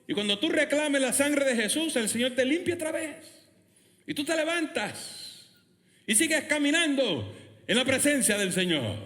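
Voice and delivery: high, ringing sermon delivery; impassioned preacher lifting them up; fervent preaching. Real, not acted. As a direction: proclaim it high to the congregation.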